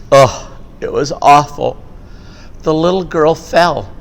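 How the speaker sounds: usual speaking voice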